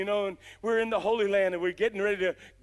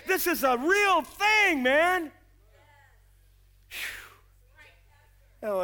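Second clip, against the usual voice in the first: usual speaking voice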